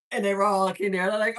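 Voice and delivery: ordinary delivery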